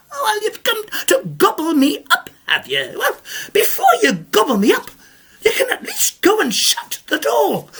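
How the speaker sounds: high, fussy scolding granny; indignant old lady. Acted. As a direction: tick him off like a prim old lady. Old lady answers ogre.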